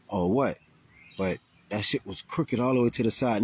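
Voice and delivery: ordinary talk, nothing marked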